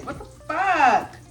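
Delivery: slow, drawn-out disbelief; shocked outburst. Real, not acted. Eyes burning from the onions, slow stunned outburst.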